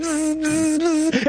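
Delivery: high buzzing like a bee; mock bee buzzing. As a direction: buzz like a pesky bee.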